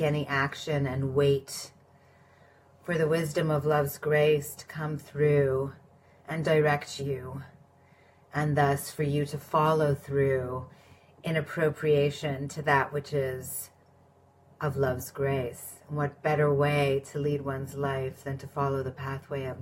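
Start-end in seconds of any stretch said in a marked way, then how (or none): none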